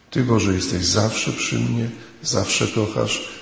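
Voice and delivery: normal talking voice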